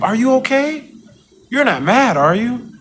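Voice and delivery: high, sing-song fake sympathy; mock concerned coworkers. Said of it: "they be trying to comfort, like".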